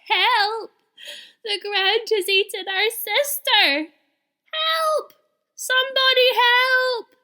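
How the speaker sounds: very high, loud panicked shouting; wailing cries for help. A narrator's reading. Rabbit brothers wailing for help after their sister vanished. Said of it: "they began to wail".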